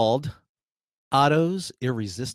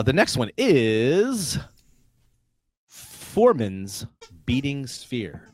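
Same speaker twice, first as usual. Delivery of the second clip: slow announcement; slower than usual. Acted. Quiz host announcing spell.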